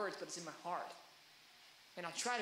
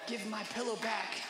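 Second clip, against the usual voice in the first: slow, put-on bedroom voice; mock sexy talk. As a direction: ham it up as seductive pillow talk. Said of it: "It's sexy talk".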